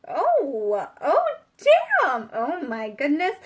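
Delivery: loud, high cry of real astonishment; astonished. Real, not acted. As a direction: exclaim in amazement, pitch leaping up. Startled by the next card drawn.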